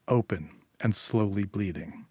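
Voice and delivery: ordinary delivery, nothing marked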